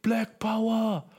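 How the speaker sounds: high, slow mock shout; retold stranger's shout. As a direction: stretch it out like a stranger yelling from a taxi. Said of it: "schreit so".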